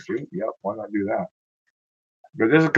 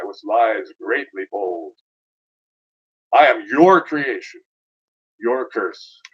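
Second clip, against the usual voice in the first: measured, theatrical verse with long pauses; dramatic poem recital; 4 dB louder, slower than usual. Acted. Infernal speaker addresses the reader of stars, dramatic recital.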